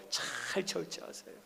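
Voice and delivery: breathy whisper, slowed right down; whispered plea. Real, not acted. Pastor whispering to the congregation to hold back. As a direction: lean in and whisper the advice like a secret. Pastor urging restraint softly.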